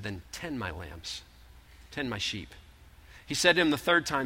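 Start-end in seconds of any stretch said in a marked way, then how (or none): 0.0-2.5 low, soft instruction; gentle command. Acted. Jesus telling Peter by the fire, low and soft. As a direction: say it quietly, close.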